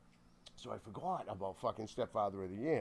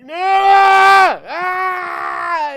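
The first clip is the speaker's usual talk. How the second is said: long, high, full-volume wail; piercing acted cry for help; re-enacted screaming for grandma; 24 dB louder, much slower than usual. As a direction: scream like a hurt kid calling Nana. Kid screaming for his grandmother.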